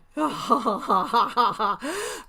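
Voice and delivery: loud laugh climbing up; real giggling fit; rising laughter. Real, not acted. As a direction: keep cracking up. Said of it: "Sorry, I'm laughing".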